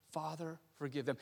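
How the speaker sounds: soft, subdued prayer; quiet plea. Acted. Jesus praying for his executioners, quiet plea. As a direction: pray it softly, with little voice.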